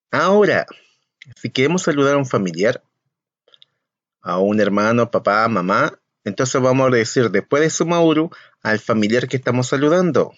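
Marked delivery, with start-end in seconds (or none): none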